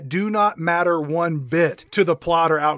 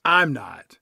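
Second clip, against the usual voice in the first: normal talking voice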